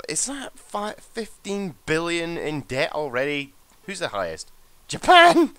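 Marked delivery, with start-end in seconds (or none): 4.9-5.6 high, loud burst of disbelief; shouted in amazed amusement; astonished outburst. Real, not acted. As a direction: blurt it out, much higher and louder. Amazed at top debt figure.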